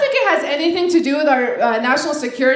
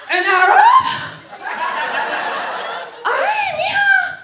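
shocked greeter; slower than usual. Acted. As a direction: exclaim in mock shock, stretching it out high.

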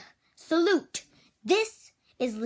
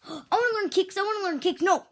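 high, gasping eager cry; eager excitement; 8 semitones higher, 4 dB louder, faster than usual. Real, not acted. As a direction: burst out wanting to learn. Kid excited about learning kicks.

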